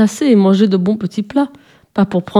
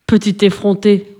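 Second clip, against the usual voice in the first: usual speaking voice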